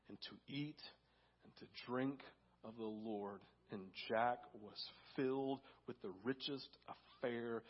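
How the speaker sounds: soft, low, hushed thankfulness; reverent preaching. Real, not acted. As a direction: let it sink in quietly, with long pauses. Hushed reverent close to the story.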